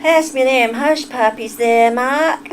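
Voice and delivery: botched Southern accent that slides into Yorkshire; Southern attempt sounding Yorkshire. Shown as a demonstration. Streamer retrying a line for Mark, Southern accent gone Yorkshire. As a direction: try Southern, land in Yorkshire. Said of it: "It sounds like a Yorkshire accent".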